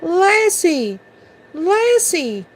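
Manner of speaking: put-on high-pitched boy's call to his dog; joyful high call of the name; shrill cry. A narrator's reading. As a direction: ring it out high, falling away. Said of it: "then his voice rang shrill".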